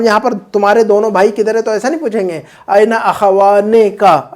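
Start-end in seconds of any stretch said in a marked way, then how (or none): 2.7-4.3 slow, drawn-out "अखवाने का" with the "ने" kept in; wrong form demo. Shown as a demonstration. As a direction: keep a long "ने" before "का" in "अखवाने का". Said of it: "ऐसा नहीं पूछेंगे हम". (गलत रूप: "अखवाने का" में "ने" खींचकर, धीरे)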